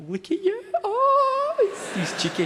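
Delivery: high, cooing voice for a newborn; doting relative cooing at a passed-around newborn, way up high; gushing baby talk. Retold or imitated. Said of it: "they can just go".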